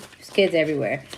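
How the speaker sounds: put-on "kids everywhere" dropped low and soft, said half to herself; muttered aside. Shown as a demonstration. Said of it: "And it was kids everywhere".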